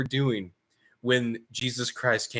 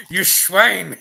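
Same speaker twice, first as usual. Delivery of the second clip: mock scolding; 7 semitones higher, 8 dB louder than usual. Jesus mocking the demons.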